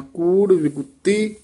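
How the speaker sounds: ਵਿਗੁੱਤੀ with a firm doubled t; teacher models ਕੂੜ ਵਿਗੁੱਤੀ with a tight double t; tight pronunciation. Shown as a demonstration. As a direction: press the ਤ in ਵਿਗੁੱਤੀ hard. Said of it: "ਇੱਥੇ ਕੱਸ ਕੇ ਉਚਾਰਨ ਕਰਨਾ ਹੈ".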